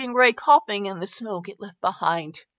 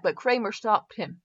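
ordinary delivery, nothing marked

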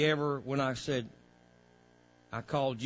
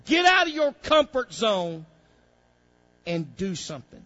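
loud, higher, driving preacher's call; full-force preaching; 7 semitones higher, 8 dB louder than usual. Acted. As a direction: preach it hard to the congregation.

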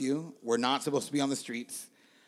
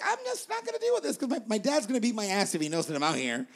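mock worried teen; 7 semitones higher than usual. Thinking as scared teen.

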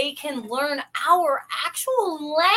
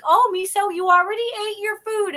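higher sing-song owner voice; owner talking to dog; 6 semitones higher, 3 dB louder than usual. Retold or imitated. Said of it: "And she'll be like".

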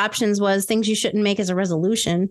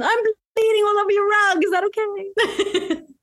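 mock polite; 11 semitones higher than usual. Host playing a bleeding guest asking the hostess, mock polite. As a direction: ask sweetly while laughing.

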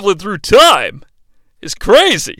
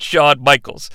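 ordinary delivery

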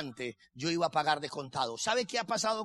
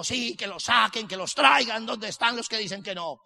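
loud, higher, fired-up challenge; emboldened bravado; 5 semitones higher, 7 dB louder than usual. Retold or imitated. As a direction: act out getting cocky and daring the doubters. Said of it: "yo me iba envalentonando". (envalentonado, retando en voz alta)

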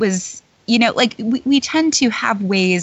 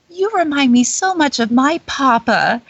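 higher, sweetly pointed princess voice; Belle; 6 semitones higher than usual. Acted. Belle knocking a vain dad down, princess voice. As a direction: put on a lilting storybook heroine. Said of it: "Belle looks at him and she goes".

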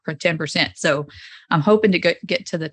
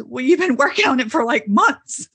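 husband's reasoning; 6 semitones higher than usual. Retold or imitated. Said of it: "He goes".

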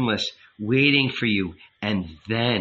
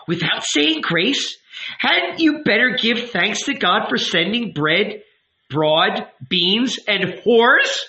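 higher, scornful taunt; mocking sarcasm; 10 semitones higher, 4 dB louder, slower than usual. Woman taunting the youth for skipping grace, sarcastic. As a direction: needle him with sarcasm.

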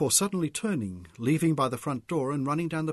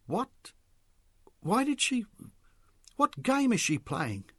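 high, halting incredulous questions; bewildered, pitch shooting up; shocked disbelief; 11 semitones higher, slower than usual. A narrator's reading. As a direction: stumble into baffled questions. Mick reacts to shocking news.

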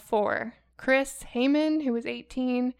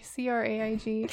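usual speaking voice

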